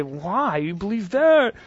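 scoffing disbelief, voice jumping up on that; incredulous scoff. Shown as a demonstration. Mocking a skeptic's view with an incredulous scoff. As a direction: sneer at their belief. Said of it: "Two totally different things. Your manner matters".

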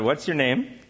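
normal talking voice

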